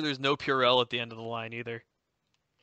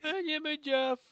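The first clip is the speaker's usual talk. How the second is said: much higher, strained mock voice; squeaky meme parody. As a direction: squeeze it out as a joke. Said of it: "friends quoting the meme".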